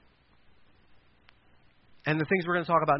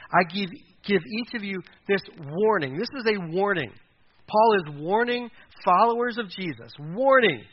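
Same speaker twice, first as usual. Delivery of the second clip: louder, higher, driving the word warning home; emphatic warning; 4 semitones higher, 4 dB louder than usual. Real, not acted. Preacher warning his congregation, loud and emphatic.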